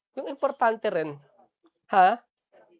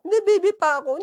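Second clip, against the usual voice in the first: put-on higher, whining kid insisting; a hammed-up child whining Hindi, baby pa ako; mock whiny child; 8 semitones higher, faster than usual. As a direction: play the stubborn child, pitched up. Child voiced in mock quarrel.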